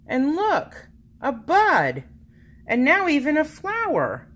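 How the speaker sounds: high, marvelling storybook reveal; bright rising wonder at the flower; delighted wonder. A narrator's reading. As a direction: show the children something wonderful. Narrator marvels at the bloom.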